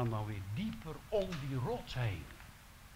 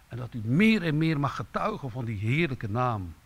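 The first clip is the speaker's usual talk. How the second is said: urgent preaching; 2 semitones higher, 7 dB louder than usual. Acted. Preacher urges congregation to grow.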